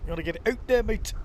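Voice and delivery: hammed-up Canadian impression, badly done; commentator's terrible mock Canadian accent. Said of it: "That's my Canadian accent".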